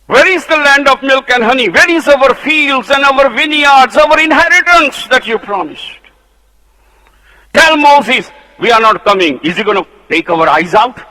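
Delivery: very high, loud indignant shouting; angry rebel demand. Acted. Rebels shout at Moses for the missing land, angrily. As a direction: demand the promised land in fury.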